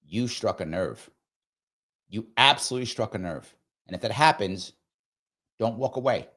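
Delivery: normal talking voice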